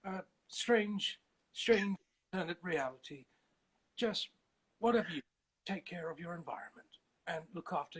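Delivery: hushed nature-documentary narrator; halting, earnest naturalist, softening toward the end; David Attenborough. Acted. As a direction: gently plead for the planet, pausing between phrases. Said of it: "David Attenborough".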